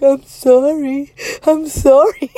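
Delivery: loud, high mock-crying apology; imitated tearful apology. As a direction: act out a drawn-out, whimpering sorry. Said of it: "He started crying".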